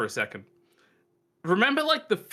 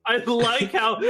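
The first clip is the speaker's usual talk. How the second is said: laughing through the words; 9 semitones higher, slower than usual. Real, not acted. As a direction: giggle while you say it. Laughing at character art.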